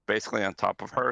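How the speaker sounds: ordinary talk, nothing marked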